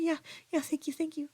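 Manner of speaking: high, hurried grateful reply; imitated upset lady. Crying lady accepting a stranger's help, imitated, high and rattled.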